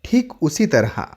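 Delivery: normal talking voice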